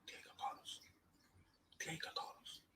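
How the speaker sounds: hushed, voiceless whisper of "Diego Carlos"; whispered repeat. Real, not acted. Fan repeating a player's name to himself, whispered.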